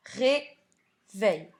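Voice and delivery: "Réveil" as ré, pause, veil; syllable by syllable. Shown as a demonstration. Teacher repeating the cut word for pupils, "Réveil" split in two. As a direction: leave a clear gap between the syllables of "Réveil". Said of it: "Je l'ai donc coupé en syllabes".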